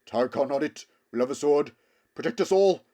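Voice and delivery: loud straining horse voice. A narrator's reading. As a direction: shout it over the gallop. Bree voiced, panting at gallop.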